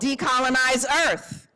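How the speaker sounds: rally the room with a prompt; calling out. Shown as a demonstration. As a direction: call it out high to the crowd. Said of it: "calling prompts to the crowd".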